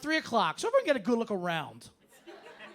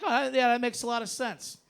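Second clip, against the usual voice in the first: normal talking voice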